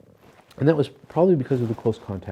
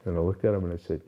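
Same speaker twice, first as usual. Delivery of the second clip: ordinary delivery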